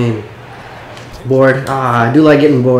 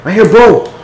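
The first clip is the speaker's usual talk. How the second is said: higher, louder call for the pass; 7 semitones higher, 4 dB louder than usual. Real, not acted. Gamer calling teammates for the pass, loud and high. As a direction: yell for the ball.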